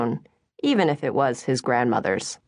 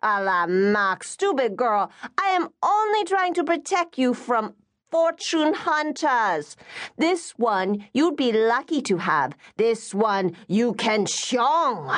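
higher, slower, bossy auntie voice; scolding Singaporean mother; 6 semitones higher than usual. Acted. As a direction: lecture your silly daughter about fortune hunters.

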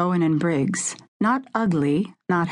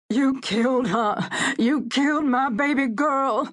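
anguished shouts; 7 semitones higher than usual. A narrator's reading. A grieving father yells at Renard in anguish. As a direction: hurl it at the killer of your daughter. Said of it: "The anguished shouts tore from the throat".

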